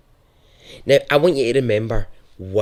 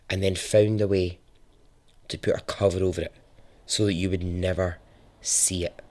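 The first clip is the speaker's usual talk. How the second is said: hushed true-crime narration; 3 semitones lower, 6 dB quieter than usual. Acted. Narrator to listeners, revealing the hidden bunker, hushed and ominous. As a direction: lean in and let the dread sink.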